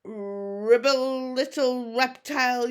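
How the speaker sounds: very loud, slow commanding bellow; yelling. A narrator's reading. Chief yelling the dragon into submission. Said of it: "yelled Stoic".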